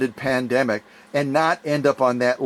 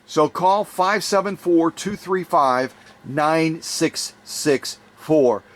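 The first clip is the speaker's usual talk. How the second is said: ordinary talk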